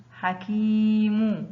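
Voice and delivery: حكيم very slowly, كي held long; drawn-out example word. Shown as a demonstration. Teacher showing pupils the long vowel, slow and stretched.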